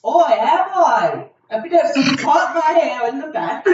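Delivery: hammed-up flea trainer; proud peasant. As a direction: boast grandly. Improvised Renaissance fair scene.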